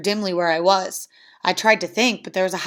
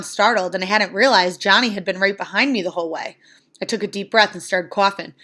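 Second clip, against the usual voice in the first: ordinary delivery, nothing marked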